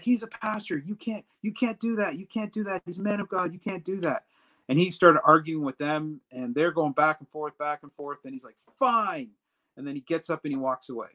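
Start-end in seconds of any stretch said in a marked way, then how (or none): 0.0-4.2 mock yelling. Customs ladies defending him to the supervisor, high-pitched mock yelling. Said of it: "They just started yelling at him". 8.8-9.3 high, falling mock-exasperated sigh of a word; exasperated giving-in. Supervisor giving in to the arguing ladies, mock exasperated.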